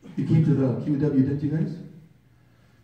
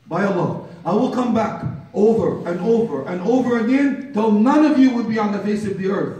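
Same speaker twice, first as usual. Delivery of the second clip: thundering angelic warning; 8 semitones higher, 8 dB louder than usual. Acted. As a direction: build it over and over into a ringing threat. Preaches angel of death's vow.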